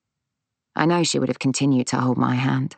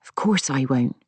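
grave, measured assurance; serious, sincere reassurance. A narrator's reading. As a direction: promise it in earnest. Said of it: "she said seriously".

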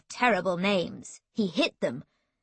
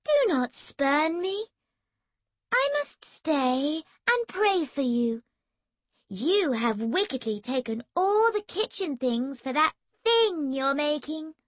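cooing; 6 semitones higher than usual. Acted. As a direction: coo the words to annoy him. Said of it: "cooed Emily".

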